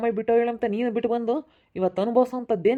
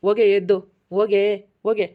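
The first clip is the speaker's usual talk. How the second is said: louder, angry order to leave; angry shout; 6 dB louder than usual. Acted. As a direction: bark them out of the house. Father drives them out. (ಕೋಪದ ಕೂಗು)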